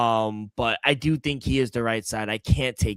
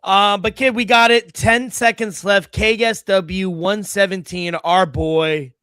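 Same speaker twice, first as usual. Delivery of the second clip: excited; 8 semitones higher, 7 dB louder than usual. Real, not acted. Hyped up to close show.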